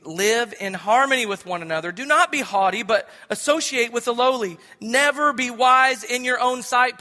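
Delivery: solemn public reading; scripture reading. Real, not acted. Reads scripture aloud.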